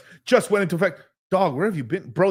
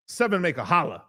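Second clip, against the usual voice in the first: normal talking voice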